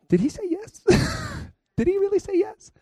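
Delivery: high, incredulous mock Peter; stunned disbelief. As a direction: squeak it out in disbelief.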